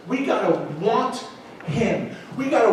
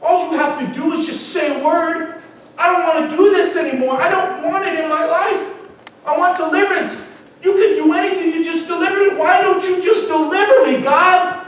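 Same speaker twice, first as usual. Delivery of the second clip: loud, high, pleading cry to God; a man finally shouting his prayer; desperate plea; 6 semitones higher, 5 dB louder than usual. Retold or imitated. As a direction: beg God out loud, at the end of your rope. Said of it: "I'm gonna get real with God".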